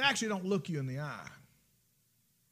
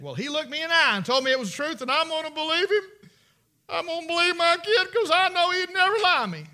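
louder, higher, gullible; mock naive parent; 10 semitones higher, 11 dB louder than usual. Preacher mocks a gullible parent believing his child. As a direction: sound sure your kid never lies.

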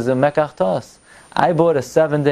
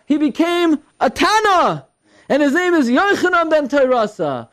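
excited telling; 9 semitones higher than usual. Real, not acted. Climax of the story, rising excitement.

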